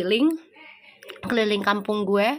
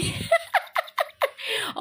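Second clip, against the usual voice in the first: high shrieking laugh; a burst of squealing laughter; 4 dB quieter than usual. Real, not acted. Laughing at Bali story. (tawa melengking)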